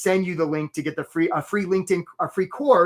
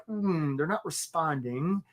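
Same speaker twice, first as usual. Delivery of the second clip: timid hesitation; 3 semitones lower, 6 dB quieter than usual. Acted. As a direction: play someone too shy to intrude, voice dropped. Acting a timid onlooker who holds back.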